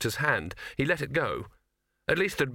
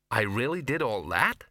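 uncertain. A narrator's reading. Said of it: "said Ginger uncertainly".

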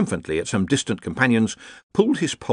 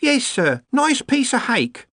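high-pitched servant woman; worried cook; slower than usual. A narrator's reading. The cook answers the visiting wizards, worried. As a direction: pipe up high as a deferential cook.

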